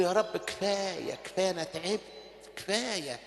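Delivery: soft, low, broken-hearted plea; weary prayer. Acted. Preacher voicing a tired sinner's prayer to God, soft and weary. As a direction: pray it quietly like an exhausted son.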